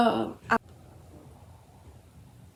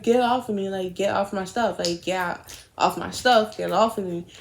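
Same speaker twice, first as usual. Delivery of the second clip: ordinary talk, nothing marked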